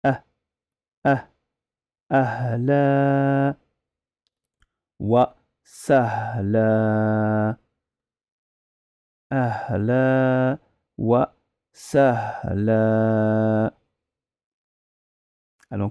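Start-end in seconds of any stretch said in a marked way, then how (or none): 2.1-7.6 "Ahla wa Sahla" slowly, with stress on "wa"; the spelled-out form "Ahla wa Sahla"; slow modelled phrase. Shown as a demonstration. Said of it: "Mais ça s'écrit". 9.3-13.7 "Ahla wa Sahla" slowly, with stress on "wa"; the spelled-out form "Ahla wa Sahla"; slow modelled phrase. Shown as a demonstration. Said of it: "Mais ça s'écrit".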